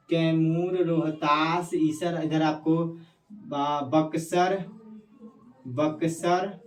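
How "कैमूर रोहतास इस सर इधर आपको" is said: usual speaking voice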